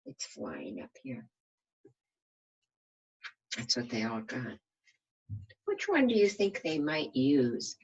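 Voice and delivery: usual speaking voice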